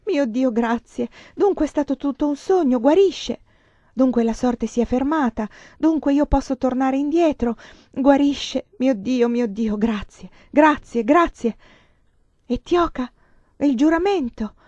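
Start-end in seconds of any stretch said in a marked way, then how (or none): 0.0-11.6 weep out thanks in relief; the news that he recovers, joyful thanks; cries of joy. Acted. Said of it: "cado per terra con grida di gioia".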